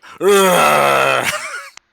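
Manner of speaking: loud rolling creature roar; monster bellow. Acted. Game master voices the attacking creature, long bellow. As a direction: bellow like a hideous beast. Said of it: "and bellows at you".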